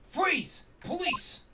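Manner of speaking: high, forceful command to freeze; police shout. Acted. Police officer bursting in on two suspects, commanding shout.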